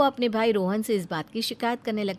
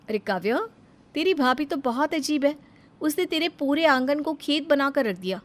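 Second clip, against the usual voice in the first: higher-pitched sneering auntie voice; gossiping neighbour; 7 semitones higher than usual. Acted. Neighbour woman mocking Kavya's sister-in-law, higher sneering gossip. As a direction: gossip about the bhabhi with scorn.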